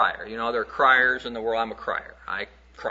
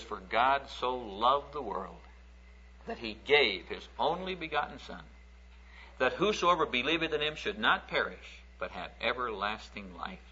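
scripture reading; 8 dB quieter than usual. Acted. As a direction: recite the verse with reverence. Quoting John 3:16 reverently.

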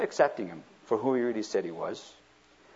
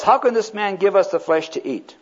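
loud, higher, indignant crowd arguing; sharp arguing; 6 semitones higher, 6 dB louder than usual. Acted. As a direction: throw the question back in loud protest. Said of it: "began to argue sharply".

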